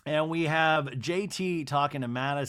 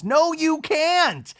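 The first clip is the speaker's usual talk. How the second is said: slow, high, loud retort; a real, indignant rebuttal; 8 dB louder, much slower than usual. Real, not acted. Host rebuts Whitney.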